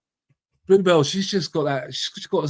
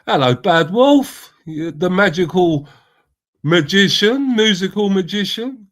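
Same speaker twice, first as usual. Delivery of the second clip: cheery greeting; 5 semitones higher, 7 dB louder than usual. Real, not acted. Greeting livestream guest.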